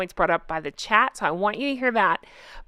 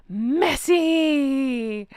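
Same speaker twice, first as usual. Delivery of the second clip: long, drawn-out mock "messy"; running-gag messy; 5 semitones higher than usual. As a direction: stretch it out high and theatrically. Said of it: "It's the same thing with".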